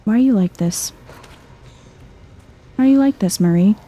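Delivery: ordinary talk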